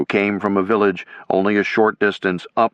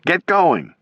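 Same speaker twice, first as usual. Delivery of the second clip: shouted; 9 semitones higher than usual. A narrator's reading. Soldiers shouting encouragement to one another. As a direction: shout it to the next man. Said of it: "they shouted at one another".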